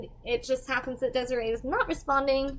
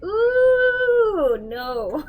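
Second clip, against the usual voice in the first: laughing refusal; 9 semitones higher, 6 dB louder than usual. Retold or imitated. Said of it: "And I said, like".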